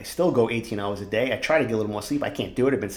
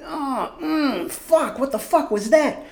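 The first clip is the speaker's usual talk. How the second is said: mock startled self; 12 semitones higher, much slower than usual. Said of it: "I'm like".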